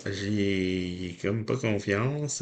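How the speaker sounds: normal talking voice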